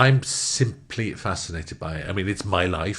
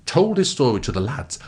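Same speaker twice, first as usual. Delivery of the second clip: ordinary talk, nothing marked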